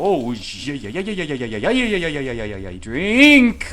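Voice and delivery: loud, high chant of yeah building to a shouted drink; excited cheering. Real, not acted. Cheering the drinking boss fight.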